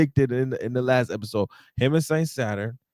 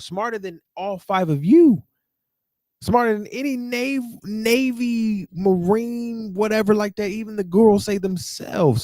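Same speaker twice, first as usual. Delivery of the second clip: rising excited rant; 8 semitones higher than usual. Real, not acted. Heated rant at Gorosei.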